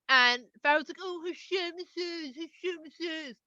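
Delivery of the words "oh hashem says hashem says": slow, soft, sneering singsong; mocking. Pharaoh scoffing at Hashem's message, mocking. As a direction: echo it mockingly, drawn out.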